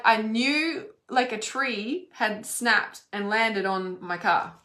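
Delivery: ordinary talk, nothing marked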